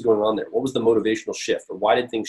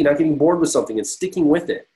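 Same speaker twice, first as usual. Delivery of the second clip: usual speaking voice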